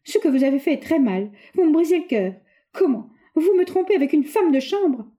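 high, loud tearful reproach; a jealous fiancée confronting Roger, near tears; near tears, accusing. A narrator's reading. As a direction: hurl the hurt at him, close to crying. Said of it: "déjà prête à pleurer de nouveau".

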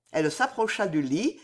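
ordinary delivery

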